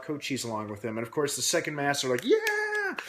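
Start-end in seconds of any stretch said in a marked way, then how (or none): 2.2-3.0 high, loud whoop of joy; cheering. Retold or imitated. Prisoners cheering from behind the fences, excited high whoop. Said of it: "they're all cheering".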